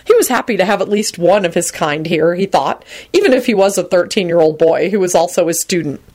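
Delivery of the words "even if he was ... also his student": plain reading voice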